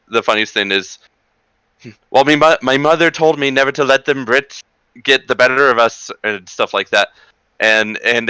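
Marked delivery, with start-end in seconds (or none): 2.1-6.1 mock Irish brogue, pitched up; a proud Irishman swearing off the Brits; Irishman from Andy Griffith. TV Irishman boasting about his mother, in a mock Irish brogue. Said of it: "American Irishman in the town of Mayberry".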